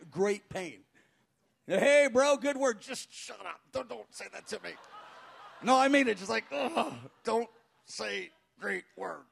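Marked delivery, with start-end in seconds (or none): 1.7-2.8 raised, louder back-slapping greeting; hearty congratulation. Retold or imitated. Churchgoer congratulates the worn-out pastor, hearty. Mimics cheery congratulations after preaching. 2.8-4.7 much softer, under the breath; muttered protest. Acted. Pastor privately rebuffs a well-wisher's praise, muttered. 6.5-9.2 groan, then slow spaced-out words; pained protest. Acted. Pastor cannot bear flattery after preaching, pained.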